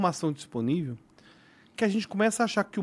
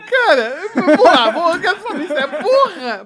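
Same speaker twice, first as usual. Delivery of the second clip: excited; 9 semitones higher, 8 dB louder than usual. Real, not acted. Excited about the topic.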